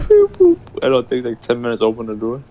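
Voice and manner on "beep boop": high squeaky beep boop; robot beeping. Retold or imitated. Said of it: "the, the beep".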